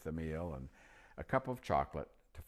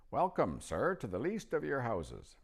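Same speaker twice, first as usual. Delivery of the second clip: put-on old magician; 7 semitones higher, 5 dB louder than usual. A narrator's reading. Narrator voicing old magician.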